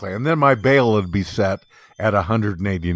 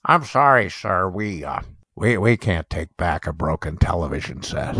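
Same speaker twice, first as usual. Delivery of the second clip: lower, flat clerk refusal; lightly voiced clerk; 5 semitones lower than usual. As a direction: play the unbothered store clerk. Quoting the store clerk.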